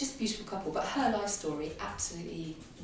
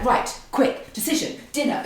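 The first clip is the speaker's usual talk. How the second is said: doctor in a rush; 6 semitones higher, 6 dB louder than usual. Retold or imitated. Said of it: "I'm like".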